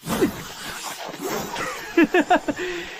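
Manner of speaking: 阴冷轻笑；低声得意的冷笑；躲在暗处的坏笑；追兵得意冷笑. Acted. 追兵得意笑声.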